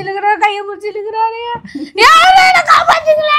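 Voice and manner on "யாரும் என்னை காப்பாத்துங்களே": high crying shout for rescue; hammed-up wail for help; mock wailing plea. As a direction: cry out for help dramatically.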